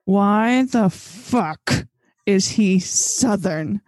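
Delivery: very slow, word-by-word incredulous question; exasperated disbelief. Real, not acted. Ranting to co-hosts about Alex's accent, slow exasperation. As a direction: draw out each word in baffled outrage.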